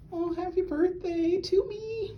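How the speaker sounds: very high and slow "happy birthday to me"; delighted singsong. Real, not acted. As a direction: coo it to yourself.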